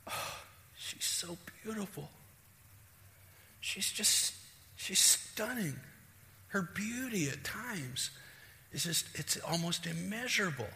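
soft, breathy awe, pitched high; adoring husband. Acted. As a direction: sigh with wonder over your wife. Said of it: "look at your wife and go".

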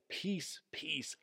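"Peace, peace" doubled for emphasis, quietly; emphatic repetition. Shown as a demonstration. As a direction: repeat "Peace, peace" as two separate, softly lifted words. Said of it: "emphasizing that word".

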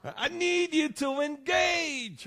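mock-frantic cry for help; screaming mother. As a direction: yell it like a fed-up mom. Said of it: "she screams at her husband".

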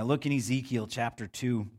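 ordinary delivery

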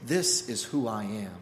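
low, soft, steady declaration; calm God. Acted. God tells Moses who he is, low and calm. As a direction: speak it with quiet authority. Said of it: "God is telling him".

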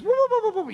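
very high rapid comic whoops; Three Stooges whooping. Retold or imitated. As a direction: imitate a slapstick scuffle with high whoops. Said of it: "something out of the Three Stooges".

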